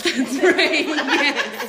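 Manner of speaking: laughing yes. Real, not acted. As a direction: laugh through the words. Laughing agreement.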